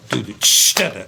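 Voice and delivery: "ch" hissed in place of the third "do"; scatted groove with a hissed "ch"; open hi-hat "ch". Shown as a demonstration. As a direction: hit the "ch" like a splashy open hat. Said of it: "open the hi-hat on the and".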